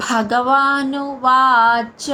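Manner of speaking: slow భగవానువాచ with a breathy aspirated భ and a long వా; భగవానువాచ said slowly, aspirated భ, drawn-out వా, short చ; aspirated bha, long vaa. Shown as a demonstration. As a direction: sound the భ in భగవానువాచ with a strong puff of breath and stretch the వా. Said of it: "భ మహాప్రాణాక్షరం".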